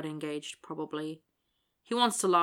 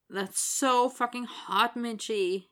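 slow, higher near-moan of admiration; almost moaning; 5 semitones higher, 3 dB louder, slower than usual. A narrator's reading. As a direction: draw it out, nearly moaning. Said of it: "Willie almost moans".

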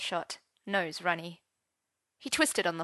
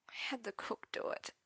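very soft, whispery admission; sheepish whisper; 2 semitones higher, 13 dB quieter than usual. A narrator's reading. Arthur confessing about the homemade soup, sheepish whisper. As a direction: admit it under your breath.

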